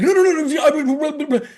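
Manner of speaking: Martin protesting. Retold or imitated. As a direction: cut him off with eager no's. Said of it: "Martin's like".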